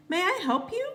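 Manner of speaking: high, bright-pitched secretary voice; polite school secretary at the front desk. A narrator's reading. Secretary greets visitor at office.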